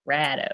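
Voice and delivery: "Rado" said low with a wide open ah: "Rah-do"; Rado the local way. Shown as a demonstration. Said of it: "I think it's that".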